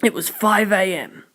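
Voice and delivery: plain reading voice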